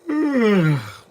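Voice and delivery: high, loud groan falling away. Real, not acted. Podcaster interrupting himself before excusing himself, loud falling groan. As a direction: let out a loud real groan, sliding down. Noise, then says excuse me.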